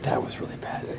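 whispered, no voice at all. Shown as a demonstration. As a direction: breathe it as a whisper.

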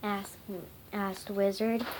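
plain reading voice

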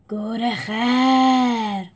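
slow, stretched animal call; a caged beast bellowing the word; loud call. A narrator's reading. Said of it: "صدایی بلند شد". (صدای کشیده و بلند حیوان)

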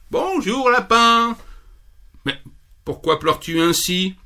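pitched high and light; a tiny friendly bee chirping hello; high buzzy bee voice. Acted. Bee greeting the crying rabbit, high and light.

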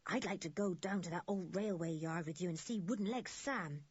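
usual speaking voice

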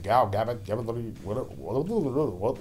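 stumbling over "Gal Gavin"; tongue-tied attempt at "Gal Gavin"; fumbled pronunciation. Real, not acted. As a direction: garble "Gal Gavin", then break into stuttered "what, what". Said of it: "don't even know how to pronounce".

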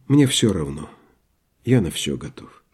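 low, soft, sinking voice; resigned. A narrator's reading. Doctor yielding to whatever comes, resigned. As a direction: give in with no fight left.

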